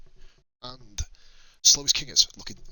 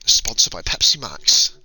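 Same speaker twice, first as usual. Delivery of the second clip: slow, louder ad-read voice, hammed up; mock sponsor plug; 6 dB louder, slower than usual. Streamer jokingly pretending Pepsi sponsors his stream, slow mock ad read. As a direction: announce it like a TV sponsor tag. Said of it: "We don't have sponsors on this channel".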